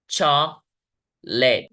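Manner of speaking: चॉकलेट very slowly as CHAAK, pause, LET; syllable by syllable. Shown as a demonstration. Teacher sounding it out for students, चॉकलेट in two parts. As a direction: split चॉकलेट in two with a gap. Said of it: "दो syllables हो गए".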